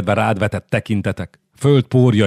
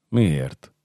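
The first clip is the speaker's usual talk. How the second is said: low, subdued choked question; 5 semitones lower, 4 dB quieter than usual. A narrator's reading. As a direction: ask it faintly, voice catching a little. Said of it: "kérdezte elcsukló hangon". (halkan, elcsukló hangon)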